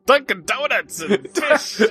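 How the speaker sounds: high, drawn-out mock Boston accent. Co-host's best attempt for Boston radio, hammed-up Boston accent. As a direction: blurt it in a badly faked Boston accent. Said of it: "That's the best we could do".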